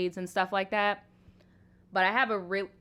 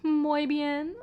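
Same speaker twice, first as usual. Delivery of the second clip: mocking others; 5 semitones higher than usual. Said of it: "Everybody's like".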